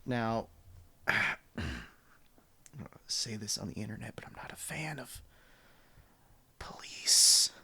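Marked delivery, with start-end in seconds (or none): 3.1-7.5 hushed whisper. Pretend. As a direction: whisper it like a secret. Joking confession about police.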